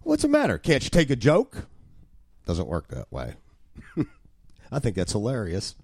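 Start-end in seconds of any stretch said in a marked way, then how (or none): 0.0-1.7 high-pitched protest of the joker; mock innocent prankster. Prankster to his burned-out neighbor, high mock innocence. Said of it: "one who shot firebrands ... then says".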